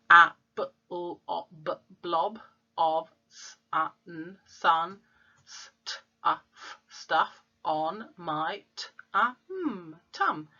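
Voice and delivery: letter sounds one by one; sounding out. Shown as a demonstration. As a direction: sound out each letter before blending the word. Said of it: "sounding out words".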